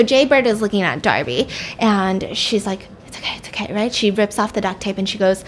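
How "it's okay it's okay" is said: soft, breathy acted comforting; whispered reassurance. She reassures Jay in the van, whispered. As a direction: soothe a captive in a near-whisper. Soothing a captive child.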